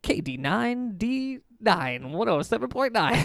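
slow, high mock station call; radio DJ voice. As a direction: draw out the call letters.

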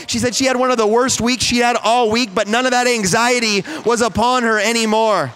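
high, loud preaching climax; preaching at full force. Acted. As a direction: build it up and preach it out. Sermon climax.

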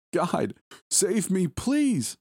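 put-on very high, slow, terrified child's cry; a little girl crying out for her life. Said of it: "I heard her pleads".

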